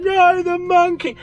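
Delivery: high, slow, hammed-up wail; fake-sob it over a lost bike; mock crying. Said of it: "started crying and going".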